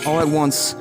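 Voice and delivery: ordinary delivery, nothing marked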